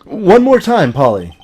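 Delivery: coaxing call. Acted. Coaxing the parrot to repeat.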